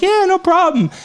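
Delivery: mock cheerful repair scheduler; cheery receptionist. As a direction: chirp it like an eager receptionist. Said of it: "And she's like".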